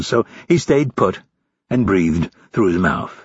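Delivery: usual speaking voice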